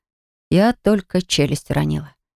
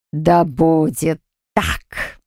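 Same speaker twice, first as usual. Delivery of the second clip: slow, solemn dark spirit; dark entity voice; much slower than usual. Acted. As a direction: pronounce it slowly, ominously.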